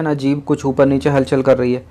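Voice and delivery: usual speaking voice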